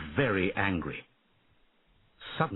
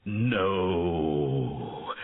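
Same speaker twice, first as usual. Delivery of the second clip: dragon's cry; 2 semitones lower than usual. A narrator's reading. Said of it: "Draco cried".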